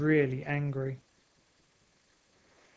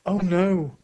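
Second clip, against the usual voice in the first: a louder alarmed "Oh no!"; 3 semitones higher, 6 dB louder than usual. A narrator's reading. Narrator gasps as a crane looms, alarmed. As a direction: cry out in alarm.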